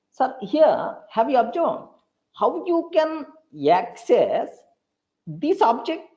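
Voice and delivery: lightly voiced student asking sir; mock student.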